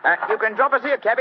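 high, loud Cockney workman; Cockney accent. Acted. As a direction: bluff Cockney, chatty and loud. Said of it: "My Cockney accent doesn't compare with yours".

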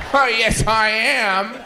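ordinary talk, nothing marked

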